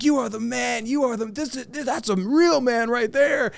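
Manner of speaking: high-pitched excited yelling, stretched out at the end; high, thrilled mock cheer of a friend; ecstatic shouting praise. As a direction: hype him up like he's a hero. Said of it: "he's, like, screaming".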